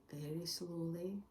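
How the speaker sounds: whispered narration. A narrator's reading. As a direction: whisper it as it fades away. Narrator hushes as rabbit vanishes.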